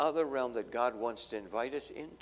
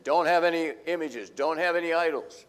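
commanding God; 7 dB louder than usual. Retold or imitated. God's command voiced.

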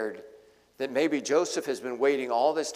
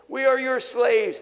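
much higher, louder cry of surrender; desperate plea; 11 semitones higher, 8 dB louder than usual. Acted. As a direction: throw yourself at his feet, crying out. Brothers throw themselves down.

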